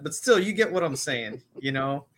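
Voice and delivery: ordinary talk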